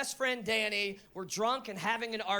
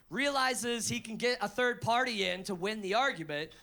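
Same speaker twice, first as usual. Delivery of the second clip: ordinary talk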